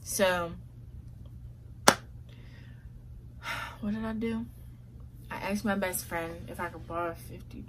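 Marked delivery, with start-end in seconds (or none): 0.6-3.8 long weary sigh. Real, not acted. Long sigh before the next step.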